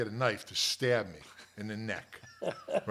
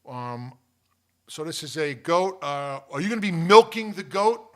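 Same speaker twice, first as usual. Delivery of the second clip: loud, raised grumpy objector; miserable sourpuss; 7 semitones higher, 10 dB louder, slower than usual. Retold or imitated. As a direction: question them like a sour board member. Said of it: "the one miserable guy gets up".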